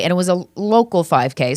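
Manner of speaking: ordinary talk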